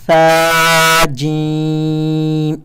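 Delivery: ثا جیم drawn out very slowly; recited letters. Shown as a demonstration. Teacher reciting letters for students. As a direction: stretch ثا جیم. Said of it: "second last word".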